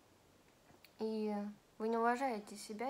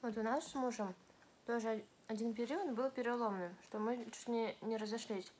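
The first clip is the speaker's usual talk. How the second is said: speaking with food in mouth; partly full mouth; chewing while talking. Real, not acted. Seen 3: Bites sushi at -5; cheeks bulge while talking throughout the words.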